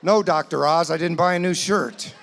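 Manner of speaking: loud, mocking snap at his wife; sarcastic retort. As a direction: throw it back at her, loud and sarcastic.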